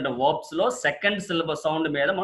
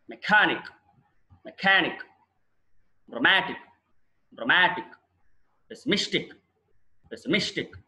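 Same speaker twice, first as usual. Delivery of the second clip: Economic to pessimistic, each punched on the syllable before -ic; penultimate stress. Shown as a demonstration. Teacher drills -ic words, second-last syllable stressed. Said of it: "penultimate syllable".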